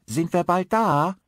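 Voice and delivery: put-on high, piercing child's voice, eager; a small boy squealing with excitement; shrill excited little boy. A narrator's reading. Narrator voices a small boy in a dream, shrill and excited. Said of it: "schrill und glasklar und erregt".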